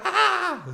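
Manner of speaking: high, loud mock yelp; hyped yelp. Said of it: "You just got me so like".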